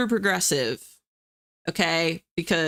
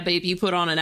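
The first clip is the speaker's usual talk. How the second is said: ordinary talk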